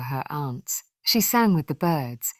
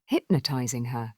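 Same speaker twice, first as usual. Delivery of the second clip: ordinary delivery, nothing marked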